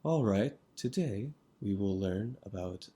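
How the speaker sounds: flat, quiet, slow monotone lecturer. Retold or imitated. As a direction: drone it out like a dull professor. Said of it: "he had the most monotone voice".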